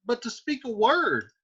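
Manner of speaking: pleading Juliet. Acted. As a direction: beg on her knees. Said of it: "And Juliet says".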